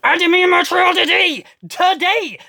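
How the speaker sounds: high, shouted demand; indignant demand. Real, not acted. Demanding a speedy trial from the government, shouting. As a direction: demand it like a wronged defendant.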